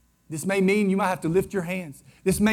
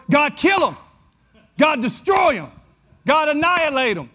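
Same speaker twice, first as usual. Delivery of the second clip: louder, higher cry to God; vehement imprecatory prayer; 7 semitones higher, 5 dB louder than usual. Acted. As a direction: call down destruction with full preacher's force. Acting David's angry prayer verse.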